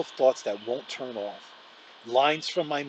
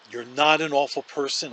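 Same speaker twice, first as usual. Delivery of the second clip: ordinary delivery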